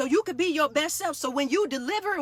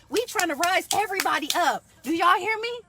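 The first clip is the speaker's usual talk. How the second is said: high, eager call to the crowd; rally the listeners; excited call-out; worked-up call; 4 semitones higher than usual. Real, not acted. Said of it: "Okay, let me calm down".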